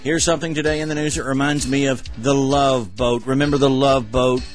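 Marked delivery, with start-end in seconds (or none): none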